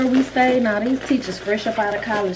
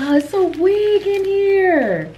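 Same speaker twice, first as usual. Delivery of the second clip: much higher and louder, slow amazed squeal; delighted squeal; 12 semitones higher, 4 dB louder, slower than usual. Real, not acted. Vlogger finding a wig in the package, high slow squeal. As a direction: squeal it out slowly, thrilled.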